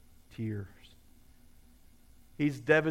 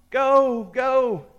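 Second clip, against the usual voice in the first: loud, high cheering yell; urging shout; 7 semitones higher, 7 dB louder than usual. Acted. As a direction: holler them on from afar. Farmers urging on their jockeys.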